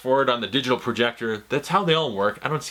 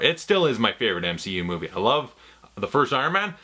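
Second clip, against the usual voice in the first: ordinary delivery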